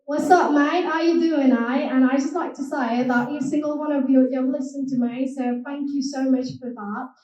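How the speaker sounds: chummy Cockney greeting and thanks; Cockney accent. Shown as a demonstration. Thanking the audience as a mate, Cockney accent.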